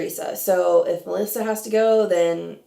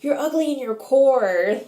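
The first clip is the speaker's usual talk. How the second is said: put-on higher, louder Staten Island accent; Jersey accent; 6 semitones higher, 4 dB louder than usual. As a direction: lay on a thick Jersey accent. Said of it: "with her Jersey accent or Staten Island accent".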